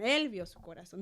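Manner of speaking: usual speaking voice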